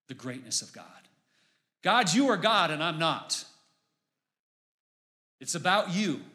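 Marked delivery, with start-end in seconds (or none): 1.8-6.2 fervent declaration. Acted. Voicing a worshipper's declaration.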